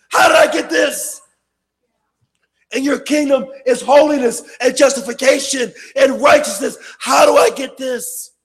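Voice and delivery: loud, high fiery preaching; preaching at full force. Acted. Preacher warning the congregation about Satan, loud fiery preaching. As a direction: thunder it from the pulpit.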